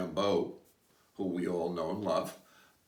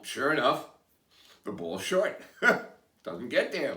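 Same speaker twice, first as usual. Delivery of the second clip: ordinary talk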